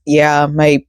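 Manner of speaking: ordinary talk, nothing marked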